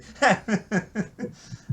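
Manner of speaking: high, loud chuckling run; real laugh. Real, not acted. Comedy fan remembering the nervy comedian, laughing. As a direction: giggle at the memory.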